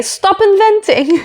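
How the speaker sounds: high, slow "Stop inventing" in a light Spanish lilt; Carlos impression. Vlogger quoting Carlos, high and slow mock scolding. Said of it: "as Carlos would say".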